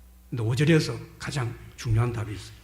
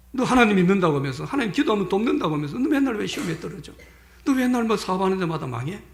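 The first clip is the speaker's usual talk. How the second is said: higher, acted accusing questions; needling taunt; 6 semitones higher, 4 dB louder than usual. Acquaintance taunting a believer for always failing, needling taunt. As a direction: needle a believer about their failures.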